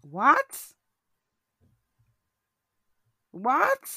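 loud high disbelieving cry; shocked. Real, not acted. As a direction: shout it in disbelief. Reacting to Apple's worth.

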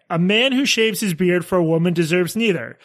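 usual speaking voice

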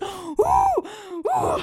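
high, drawn-out whoops; mimed effort noises. Pretend. Woman mimes swinging her car door, high effort noises.

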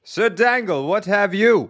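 high, booming English gentleman host; hearty booming country squire. Acted. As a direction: play a jolly old country squire hosting the show. Host's squire persona again.

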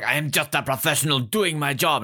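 comic German henchman pleading his case; mock German accent. Quoting the henchman before the cold kill, mock German accent. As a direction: imitate the German villain, lightly hammed up. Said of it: "when it's just like".

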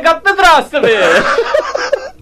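put-on slow Italian accent with laugh. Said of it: "Italian accent".

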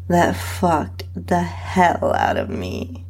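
ordinary delivery

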